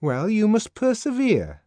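high, loud moralizing; voiced Fanny, sententious. A narrator's reading. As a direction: preach a maxim. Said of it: "said Fanny sententiously".

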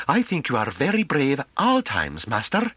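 high-pitched, deferential character voice; high, artless admiration; timorous woman. A narrator's reading. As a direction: voice a meek woman addressing her master. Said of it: "in a timorous manner".

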